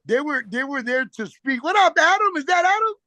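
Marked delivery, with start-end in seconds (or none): 1.6-2.9 higher, louder happy hello to a friend; spotting a buddy on the call, excited shout-out; excited greeting. Real, not acted. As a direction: call out warmly surprised to see him.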